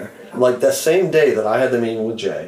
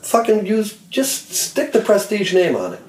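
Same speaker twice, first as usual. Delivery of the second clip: pitched way up, exasperated; worked-up rant; 11 semitones higher, slower than usual. Real, not acted. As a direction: fire it off in disbelief. Ranting about the label.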